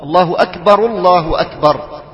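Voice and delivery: الله أكبر with the ra of أكبر taking u, linked as akbaru llah; first أكبر ends in akbaru, joined; joined with damma. Shown as a demonstration. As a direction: carry the ra on with a u vowel into the next الله. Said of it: "بالوصل والضم".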